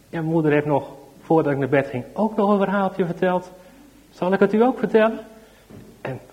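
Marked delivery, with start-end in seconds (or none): 0.1-5.2 put-on high, loud, eager little boy; light up and offer the story; boy eagerly offers to tell the policeman a story; eager child. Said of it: "zijn ogen gaan stralen".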